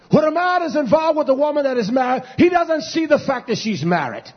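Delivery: loud, high, driving pulpit preaching; preaching at full force. Acted. Preacher warning the congregation against sinners, full-force preaching. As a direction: hammer it home from the pulpit like a revival preacher.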